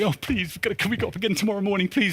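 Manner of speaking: fast, wheedling plea; hurried, pestering please; whiny pleading. Retold or imitated. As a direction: rush the begging out. Begging again to go up.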